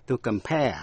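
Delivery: "compare" stressed on its second syllable. Shown as a demonstration. Teacher showing listeners where stress falls, second-syllable stress on "compare". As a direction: put the weight on the end of "compare". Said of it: "nhấn mạnh vần nhì".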